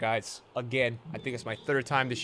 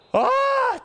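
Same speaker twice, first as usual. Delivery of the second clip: exasperated cry; 7 dB louder than usual. Real, not acted.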